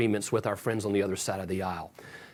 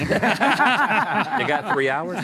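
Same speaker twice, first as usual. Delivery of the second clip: laughing; 8 semitones higher, 3 dB louder, much slower than usual. Real, not acted. Joking with a laughing colleague.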